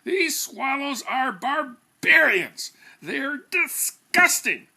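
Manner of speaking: hammed-up spluttering rage at the swallows; sputtering outrage. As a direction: splutter it out in indignant fury. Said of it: "J P sputtered".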